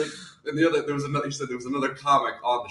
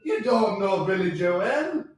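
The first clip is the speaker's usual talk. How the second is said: slow, drawn-out put-on Irish; Irish accent; 3 semitones higher, much slower than usual. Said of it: "that good at an Irish accent".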